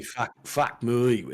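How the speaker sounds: "me." stretched as moi. Shown as a demonstration. Mates trading Australian slang, slow "fuck me." as moi. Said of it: "M O I".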